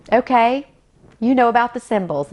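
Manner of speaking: stunned surprise. Retold or imitated. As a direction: blurt it out, floored by what she knows. Stunned that she knows his symbols.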